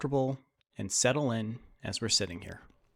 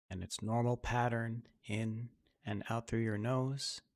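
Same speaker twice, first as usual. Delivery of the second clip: guided meditation; 3 dB quieter than usual. Acted. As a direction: ease them into calm breathing, unhurried. Leads a guided breathing meditation.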